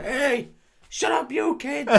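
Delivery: high-pitched mock heckler voice; random guy heckling. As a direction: call out high like an irritated passer-by.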